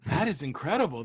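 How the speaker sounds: ordinary talk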